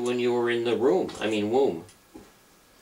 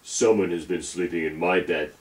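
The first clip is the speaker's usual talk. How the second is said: big Papa Bear shout. Acted. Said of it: "shouted in his great big voice".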